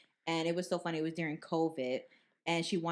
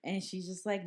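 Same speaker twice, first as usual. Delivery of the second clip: ordinary delivery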